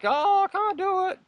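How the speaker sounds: high, slow whining voice; whiny quitter. Retold or imitated. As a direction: whine it like a quitter. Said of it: "Don't, don't, like".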